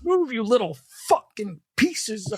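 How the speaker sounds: high mock road rage yell.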